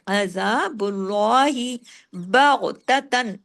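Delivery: अजाबुल्लाही बगततन very slow, तन said clearly; slow recitation; clear izhar. Shown as a demonstration. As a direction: recite with clear nun, drawn out. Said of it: "पूरे इजहार के साथ".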